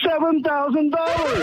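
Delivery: high, drawn-out elated announcement; thrilled, pitched well up. Real, not acted. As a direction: announce the windfall with real excitement, stretched out. Caller reveals his free silver winnings.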